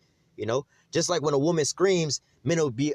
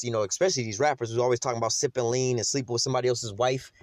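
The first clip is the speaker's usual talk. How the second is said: ordinary delivery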